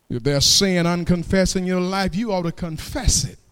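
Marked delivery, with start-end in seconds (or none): none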